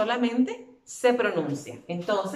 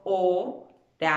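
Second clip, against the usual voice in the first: o-ra-ción, slowly, a pause after each syllable; spelled by syllables; 4 dB louder, slower than usual. Shown as a demonstration. Teacher dividing the word for pupils, oración syllable by syllable. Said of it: "la voy deletreando".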